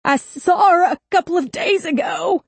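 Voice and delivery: gritted teeth. Acted. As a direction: force it out through gritted teeth. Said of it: "he managed through gritted teeth".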